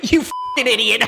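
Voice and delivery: mock cackling mom, high pitched; hysterical laughing. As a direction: jeer through a hammed-up laughing fit. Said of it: "They're laughing hysterically at him".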